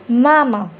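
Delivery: "मामा" with a drawn-out "aa"; long vowel. Shown as a demonstration. Teacher demonstrates the long vowel to students, stretched "aa". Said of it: "वो ज़्यादा लंबा खींचता".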